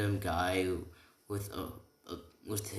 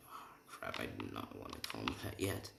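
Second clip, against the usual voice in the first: hushed whisper behind a hand; 10 semitones lower, 10 dB quieter, faster than usual. Retold or imitated. Deku, after blurting granddad, whispering to himself. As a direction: whisper it to yourself after a slip.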